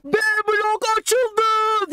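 high, slow triumphant yell; stretched-out victory shout; shouted announcement. Retold or imitated. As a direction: call it out to the whole school. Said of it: "diye bağırdık".

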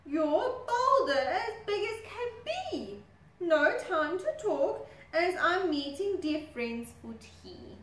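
high, loud hurried excuse; flustered crocodile. Acted. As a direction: bluster away in a high voice.

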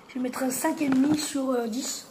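talking through fingers; speaking behind hand; hand over mouth. Real, not acted. Seen 0: Boy in sun shirt talks with fingers pressed to lips.

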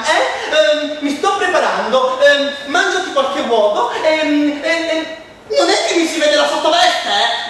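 high, loud fussing mum with ehm; flustered mother. Acted. Mother voiced. (mamma agitata, acuta e forte)

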